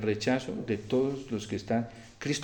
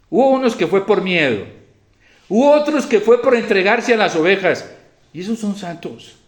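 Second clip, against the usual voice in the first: loud, raised, insistent proclamation; forceful preaching; 8 semitones higher, 10 dB louder than usual. Real, not acted. Preacher honouring priests who died for the sheep, forceful preaching.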